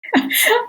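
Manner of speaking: a quick real laugh of recognition. Real, not acted. Host laughing along with the guest's love of Italian wine.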